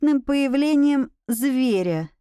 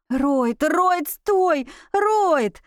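calls climbing higher each time; urgent calling; 5 semitones higher, much slower than usual. A narrator's reading. Woman chasing the werewolf to stop him, urgent calling. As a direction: cry out after him, rising in pitch.

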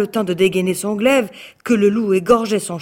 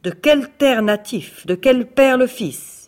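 louder and higher, slowing on the last words; angry questioning; 5 semitones higher, 5 dB louder than usual. Acted. Tsar interrogating the captured prince, angry and slow. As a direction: hammer each question at him. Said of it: "très en colère".

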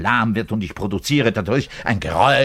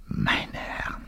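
soft breathy whisper; 7 dB quieter than usual. Acted. Bass player whispers in awe at his own daring.

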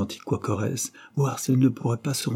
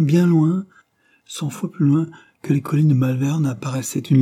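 voix haute et suspendue, comme devant un rêve; récit visionnaire; 5 semitones higher, 4 dB louder than usual. A narrator's reading. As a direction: raconter son rêve comme une histoire véritable. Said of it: "comme s'il voyait son rêve". (vision qui s'amplifie, de plus en plus haut et fort)

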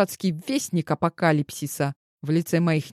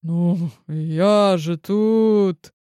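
grumbled; 4 dB louder, much slower than usual. A narrator's reading. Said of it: "буркнул мне в ухо Липаев".

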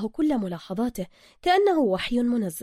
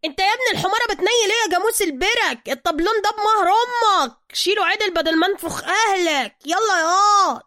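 much higher, louder berating; furious boss yelling; 10 semitones higher, 8 dB louder than usual. Acted. Zergina yelling at a clumsy worker, much higher and louder. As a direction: tear into the worker after the slap. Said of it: "وهو يجز على شفتيه".

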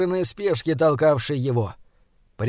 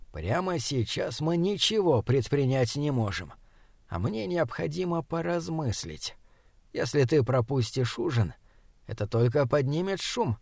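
quiet and firm; 7 dB quieter than usual. A narrator's reading. Doctor quietly instructs the boy.

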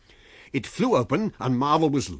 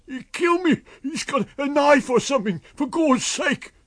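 very high, loud frantic screams; panicked screaming; 7 dB louder than usual. A narrator's reading. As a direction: scream in mortal fear.

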